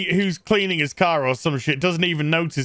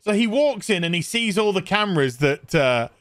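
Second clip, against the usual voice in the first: ordinary delivery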